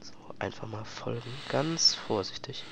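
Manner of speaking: soft, sneaky whisper; hushed so the guard won't hear. Real, not acted. Sneaking past guards quietly.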